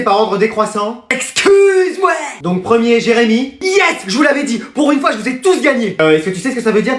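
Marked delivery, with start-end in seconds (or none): 1.1-2.1 high, drawn-out shriek of disbelief; incredulous shock. Acted. Pupil reacts to marks being ranked, incredulous shriek. 3.6-6.0 high victory cry; gleeful triumph. Acted. Pupil wrongly thinks he ranked first, gleeful triumph.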